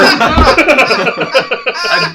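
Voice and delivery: high loud laughing fit; loud laughter. Real, not acted. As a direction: keep laughing hard. Group cracks up.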